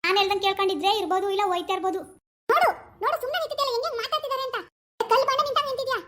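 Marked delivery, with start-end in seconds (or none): 2.5-6.0 put-on a higher, nagging young wife; daughter-in-law role. Actor voices daughter-in-law scolding husband about mother. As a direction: complain sharply to husband about his mother.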